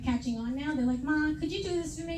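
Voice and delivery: normal talking voice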